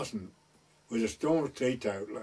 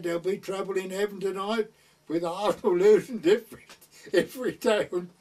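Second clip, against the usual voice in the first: laughing as she quotes; 6 semitones higher, 5 dB louder than usual. Retold or imitated. Rector's daughter quoted, laughing.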